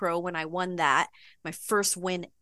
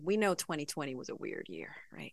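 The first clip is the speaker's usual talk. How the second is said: breathy conspiratorial whisper, much softer; 5 semitones lower, 10 dB quieter, faster than usual. Real, not acted. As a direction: whisper it like a secret to the host. Secret aside about weird year.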